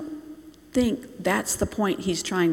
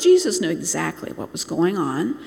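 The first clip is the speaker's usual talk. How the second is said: ordinary talk, nothing marked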